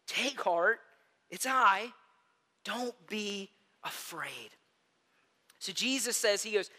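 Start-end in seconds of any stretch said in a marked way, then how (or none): 0.1-4.5 gentle reassurance. A narrator's reading. Jesus calms frightened disciples.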